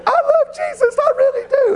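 startled lady answering Daniel in a high voice; little old lady. Retold or imitated. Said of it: "She said".

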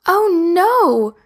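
high, loud "oh no"; sympathetic gasp. Acted. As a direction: gasp for the poor bear. Sophie sees the hurt bear.

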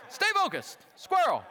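high, sudden jolt back on track; squeaky self-tease. Pretend. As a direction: snap yourself out of a tangent. Self-mocking distraction.

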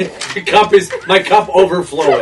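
laughing while telling it. Real, not acted. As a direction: laugh through the words. GM laughing describing spew.

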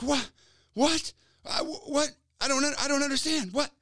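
high, fast disbelieving cries; freaking out. Retold or imitated. Girls stunned by the healed leg, shrieking high. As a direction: girls shrieking in shock at the healing.

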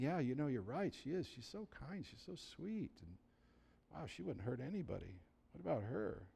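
a hint of doubting murmur; hushed musing. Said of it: "then I started thinking".

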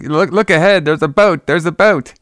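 hammed-up call to look ahead; imitating the girl's alarmed warning; mock alarm. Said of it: "And, like".

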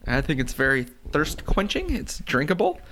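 usual speaking voice

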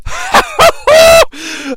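very high shouted cackle; lecherous cackle. Retold or imitated. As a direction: cackle like a drunk old lecher. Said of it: "grin on his face like".

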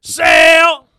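loud high punchline shout. Acted. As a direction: yell it like a stadium announcer.

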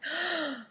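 sharp mock-shocked gasp. Host acting a horror fan scandalized she skipped the original, mock gasp.

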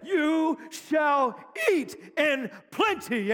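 slow, high shouted proclamation; proclaimed scripture. Real, not acted. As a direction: stretch every word and ring it out. Preacher quoting scripture, excited.

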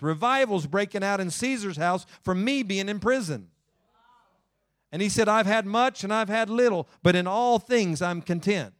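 fervent preaching. Acted. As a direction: preach it out to the congregation with fire. Preacher building to full force.

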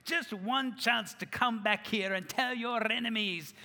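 rousing Scottish battle speech, building to a shout; Scottish accent. Retold or imitated. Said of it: "afraid that was gonna turn into a Jamaican accent".

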